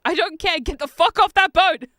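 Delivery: loud, high, fed-up yell; exasperated shout. Retold or imitated. Wife yelling at the movie to get off the boat, loud and fed up. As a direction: blast it like she's had enough. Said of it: "And she's like".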